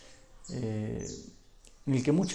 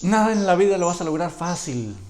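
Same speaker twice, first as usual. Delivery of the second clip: louder, higher, driving it home; preaching emphasis; 5 semitones higher, 8 dB louder than usual. Real, not acted. Preacher exhorting the congregation, louder and higher. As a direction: proclaim it to the whole church.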